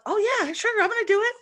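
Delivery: mock breezy bravado. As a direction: toss it off like it's no big deal. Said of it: "And so I'm like".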